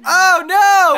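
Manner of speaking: loud, high wail of dismay; dismayed shout. Real, not acted. Player watching a play go wrong, dismayed shout. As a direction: cry out as the play goes wrong.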